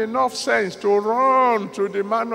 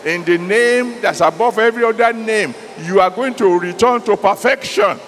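loud, urgent declaration; preaching at full force; 6 dB louder than usual. Acted. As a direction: proclaim it over the crowd.

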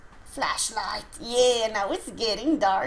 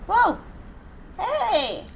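surprised shout; 10 semitones higher, 5 dB louder than usual. Real, not acted. Startled by game event.

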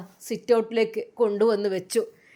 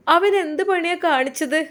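upset outcry; 5 semitones higher, 4 dB louder than usual. Acted. Weeping mother demands answer.

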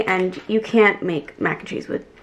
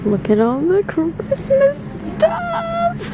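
delighted squeal; much slower than usual. Real, not acted. Excited by Christmas display.

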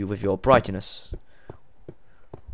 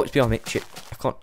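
ordinary delivery

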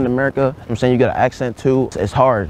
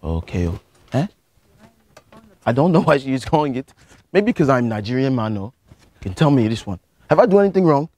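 put-on lightly held Nigerian accent, earnest student; a Nigerian student pleading with the teacher. To a geography teacher, a mild Nigerian accent. Persona reacts to security call.

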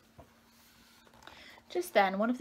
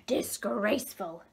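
drawn-out, mildly shouted outrage; mock shout; 3 dB louder, slower than usual. A narrator's reading. Nosy neighbour scolds the little fish, mildly shouted. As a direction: draw it out slowly as a restrained storybook shout. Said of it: "shouted the nosy neighbour fish".

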